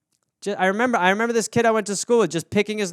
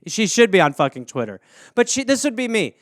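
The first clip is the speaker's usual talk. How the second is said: ordinary talk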